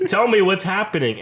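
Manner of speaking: high, loud acted demand; exasperated pleading. Student begging professor to explain, acted exasperation.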